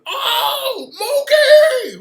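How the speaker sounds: high-pitched, hammed-up holler; mock yelling. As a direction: imitate a woman yelling for someone. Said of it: "Some sister back there yelling".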